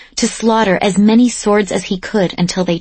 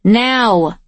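roaring; 3 semitones higher than usual. A narrator's reading. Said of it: "he roars".

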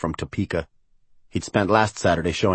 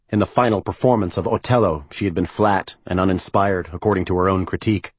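ordinary delivery

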